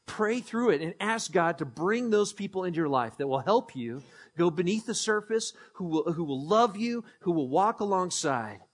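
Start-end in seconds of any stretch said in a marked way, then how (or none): none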